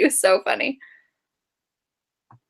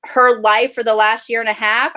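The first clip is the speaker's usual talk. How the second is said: usual speaking voice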